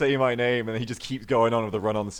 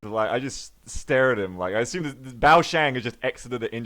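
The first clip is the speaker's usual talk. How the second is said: usual speaking voice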